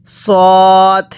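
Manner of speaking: সদ with one long rounded o, no wa glide; held, heavy model সদ; correct letter name. Shown as a demonstration. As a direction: stretch সদ as "sooo-d" on a level note. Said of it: "এরকমভাবে শিখব".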